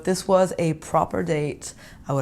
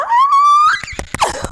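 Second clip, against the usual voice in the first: high shrieking laugh of delight; a burst of giddy screaming laughter; excited squealing laugh; 8 dB louder than usual. Real, not acted. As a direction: squeal with real joy. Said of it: "I'm, like, so excited".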